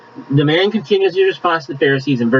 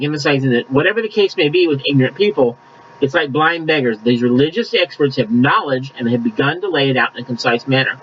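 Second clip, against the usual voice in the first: normal talking voice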